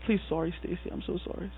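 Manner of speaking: soft, almost whispered apology; embarrassed hush. Retold or imitated. Preacher apologizing to Stacy, hushed and embarrassed. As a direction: shrink into the apology, voice fading.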